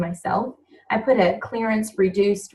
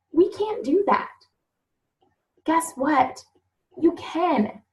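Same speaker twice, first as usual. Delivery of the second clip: high, choked-up plea; really getting emotional, pitch well up, with pauses; 6 semitones higher than usual. Real, not acted. Team leader moved by a struggling mom's story, emotional. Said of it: "sorry I'm so emotional".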